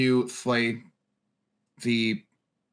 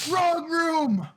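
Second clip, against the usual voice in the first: laughing; 3 dB louder, much slower than usual. Real, not acted. Still laughing, high voice.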